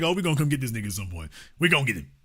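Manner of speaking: low, hushed, rapid aside; imitating schemers biding their time; quick, quiet threat under the breath; plotting undertone.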